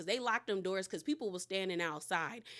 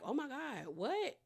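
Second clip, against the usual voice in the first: hushed, higher-pitched mock gasp of disbelief; shocked retold reaction; 4 semitones higher, 7 dB quieter than usual. Castmate reacting to news of a cheating husband, hushed shock. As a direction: softly imitate someone taken aback. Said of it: "Shamia is like".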